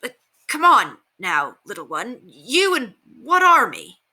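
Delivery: a guard blustering to hide his confusion; sputtering guard. A narrator's reading. Guard scoffing at the girl, sputtering and slow. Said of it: "The guard continued to sputter".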